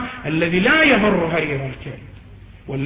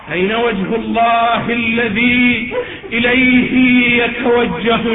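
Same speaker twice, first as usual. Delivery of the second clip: sustained, raised preaching recitation; fervent devotional declamation; intoned supplication; 4 semitones higher, 4 dB louder than usual. Acted. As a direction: hold one raised tone and draw out the vowels.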